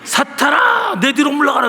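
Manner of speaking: high sharp rebuke; mock rebuke. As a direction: snap it at yourself as if the pastor were told off. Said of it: "나한테도".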